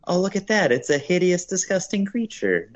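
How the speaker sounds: high-pitched cartoon do-gooder; Flanders. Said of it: "he's like".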